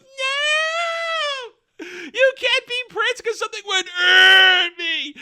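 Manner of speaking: very high, hammed-up shriek; mock screaming wail. Disa protesting to Durin, shrieking mock wail. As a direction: wail it at full comic pitch.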